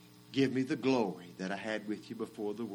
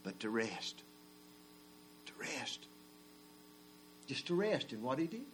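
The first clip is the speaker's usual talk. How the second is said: hushed preaching; 12 dB quieter than usual. Acted. Preacher quietly offering rest after the climax. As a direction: let it fall to a near hush.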